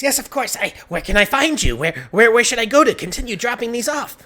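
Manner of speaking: high, loud, flustered questions; panicked villager. Acted. Villager asks the devil where to deliver, panicked and eager. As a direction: stumble eagerly over yourself to obey.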